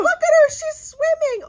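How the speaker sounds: high, loud mock holler; retold excited shout. Boy pointing at the dog in the pond, shouting.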